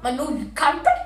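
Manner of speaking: loud, rising indignation; outraged. Retold or imitated. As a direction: build to a high, furious complaint. Outrage at finding the spill.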